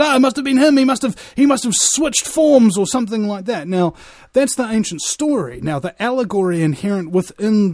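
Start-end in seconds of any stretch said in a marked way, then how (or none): 0.0-3.7 higher, louder mock exclamation of villagers; imitated villagers. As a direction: quote superstitious villagers jumping to a conclusion. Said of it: "and then they said".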